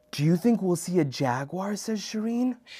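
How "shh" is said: ordinary delivery, nothing marked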